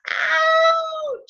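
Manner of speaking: very high loud yelp of pain; loud high hurt cry. Retold or imitated. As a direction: yelp as if hitting the ground. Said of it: "she cried".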